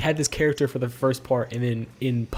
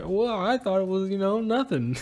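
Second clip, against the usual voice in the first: higher, slow sheepish mock reply; sheepish excuse; 7 semitones higher than usual. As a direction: make a lame excuse after being told off.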